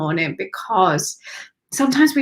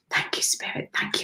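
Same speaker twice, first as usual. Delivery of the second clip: soft unvoiced whisper of gratitude; whispered thanks; 9 dB quieter than usual. Real, not acted. Spiritual reading, whispered thanks.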